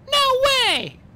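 very high, loud shriek; piercing high-pitched protest. A narrator's reading. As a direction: shriek a flat refusal. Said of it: "Sonny shrieked".

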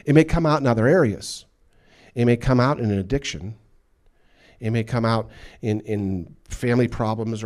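loud, emphatic, pounding each line; forceful preaching. Real, not acted. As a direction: hammer the point home to the congregation. Preaching hard.

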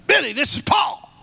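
high put-on voice of a caller; imitating Paul. Retelling a friend's call, imitating him in a high voice. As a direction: pitch it up to mimic a friend on the phone. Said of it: "that's how he talks".

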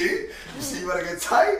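high laughing taunt. Real, not acted. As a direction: laugh through the tease.

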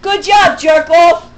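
loud, high shouted insult; furious jeer. Real, not acted. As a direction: yell it as scornful mock praise. Sarcastic insult thrown at absent person.

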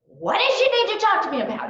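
loud, high, fake-sweet sarcasm; sugar-coat it with loud high sarcasm; sarcastic. Mom calling out to the teacher, loud and fake-sweet. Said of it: "I'm like".

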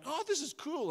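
put-on high, bright surprise of a boy finding treasure; a kid's excited discovery; delighted kid. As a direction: squeal it like an eight-year-old.

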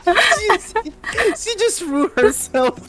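high-pitched, helpless laughing voice; cracking up. Real, not acted. Teasing friend who threw herself in the river, cracking up. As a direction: squeal it out through laughter.

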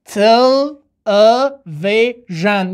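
تل ا و ژن, each syllable stretched and separate. Shown as a demonstration. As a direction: say تل ا و ژن very slowly, broken in four. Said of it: "راه حل چیست".

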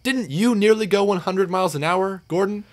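high, innocent recollecting voice; Edward, questioning. A narrator's reading.

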